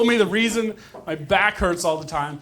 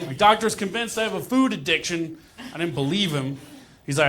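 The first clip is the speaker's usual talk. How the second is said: ordinary talk, nothing marked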